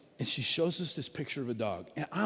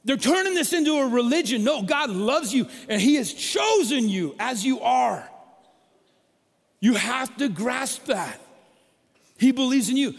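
high, loud and pleading, slowed down; Paul urging his churches not to listen; fervent, raised-voice appeal; impassioned plea; 8 semitones higher, 7 dB louder than usual. Acted. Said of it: "Paul kept writing letters to say".

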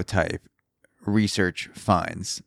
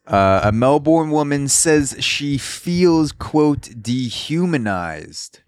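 mock dramatic reading; 6 semitones higher, slower than usual. Reading quote in mocking voice.

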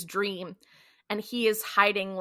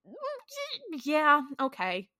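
reluctant agreement; 7 semitones higher, slower than usual. Retold or imitated. Sam reluctantly agrees to Dean.

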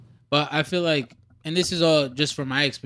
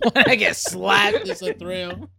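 high, slow mocking echo; 7 semitones higher, 5 dB louder, slower than usual. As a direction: parrot his line back, teasing. Mocking friend's line.

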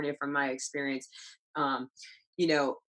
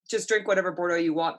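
normal talking voice

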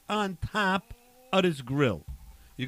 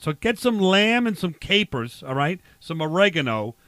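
put-on broad Boston accent with dropped r's. Barbecue guy giving co-host a recipe, Boston accent. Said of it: "use a Boston accent".

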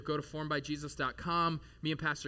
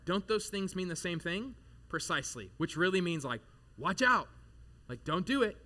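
normal talking voice

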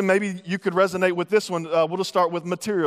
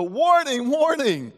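mock warning call; 6 semitones higher, slower than usual. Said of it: "you've gotta go".

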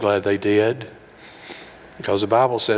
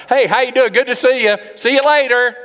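much higher, louder, brushing-off cheeriness; breezy drive-by greeting; 13 semitones higher, 7 dB louder than usual. Retold or imitated. Passing acquaintance brushing someone off, high loud fake cheer. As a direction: toss off a hollow hello and move on. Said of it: "That's called a drive-by".

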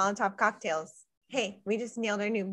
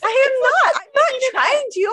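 high, loud indignant squeal; indignant protest; 11 dB louder than usual. Real, not acted. Friend teases her accent, she protests high and loud. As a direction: yelp it out, much higher and louder. Indignant denial, much higher and louder.

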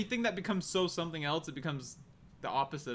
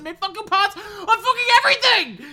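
angry; 13 semitones higher, 9 dB louder than usual. Acted. Ranting about Funko Pops.

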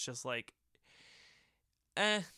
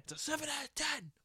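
mock reviewer repeat; 13 semitones higher than usual. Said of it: "And it's a seven out of ten".

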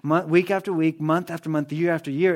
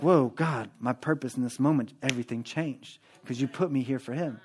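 much softer, lower, quietly stunned; awed hush; 5 semitones lower, 9 dB quieter than usual. Acted. Believer at work realizing why God placed them, in awed quiet. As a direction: let the realization sink in, almost breathless.

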